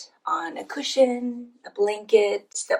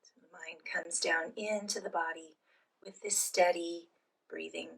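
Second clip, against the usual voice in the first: soft, unhurried phrases with long pauses; guided meditation; 4 dB quieter than usual. Real, not acted. Leads guided breathing meditation.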